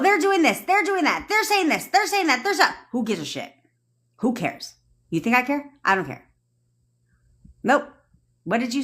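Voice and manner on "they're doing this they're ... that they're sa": high mocking singsong list. Mocking other people's chatter in a high singsong. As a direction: mimic the busybodies in a high singsong.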